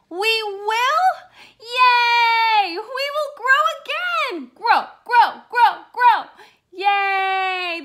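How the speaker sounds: high, loud chanting; chanting with glee; joyful cheer. Acted. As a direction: celebrate growing again. Plants cheer they will regrow.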